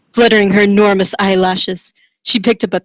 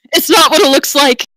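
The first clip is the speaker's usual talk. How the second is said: panicked denial; 7 semitones higher than usual. Acted. Marcus caught with Tonda.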